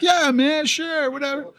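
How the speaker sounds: high-pitched, offhand yes; breezy agreement. Retold or imitated. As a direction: re-enact an easygoing, careless yes. Retelling own breezy agreement.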